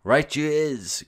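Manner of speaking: high, loud, slow giant cry; cried out. A narrator's reading. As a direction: crow it out gleefully as a giant. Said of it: "cried the blood bottler".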